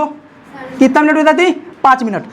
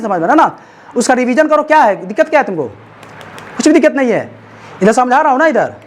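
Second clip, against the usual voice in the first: ordinary talk, nothing marked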